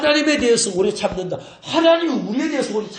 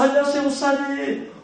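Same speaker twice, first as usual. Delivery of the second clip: bright smiling hello to the pastor; smiling greeting; 6 semitones higher than usual. Shown as a demonstration. Pastor shows how members should greet him, smiling. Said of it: "아, 웃으면서".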